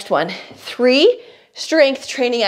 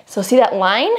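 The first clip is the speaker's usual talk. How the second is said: usual speaking voice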